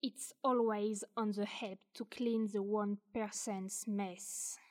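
ordinary talk, nothing marked

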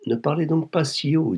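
cheeky, over-familiar hushing. A narrator's reading. Blackmailer to the angry wife, insolently familiar. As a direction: brush off the outburst with easy insolence. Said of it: "avec une familiarité offensante".

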